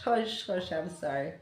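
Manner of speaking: ordinary talk, nothing marked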